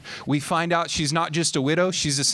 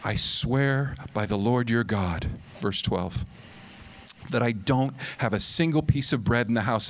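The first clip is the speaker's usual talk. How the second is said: despairing widow; 7 semitones lower than usual. Acted. Widow answers Elijah in despair.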